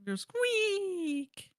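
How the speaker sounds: high squeaky eagle screech. Shown as a demonstration. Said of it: "that's my impression of an eagle".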